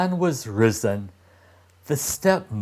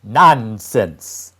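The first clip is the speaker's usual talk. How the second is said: louder, dismissive snap; scornful stepmother; 8 dB louder than usual. Acted. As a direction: cut the boy off sharply. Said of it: "she replied".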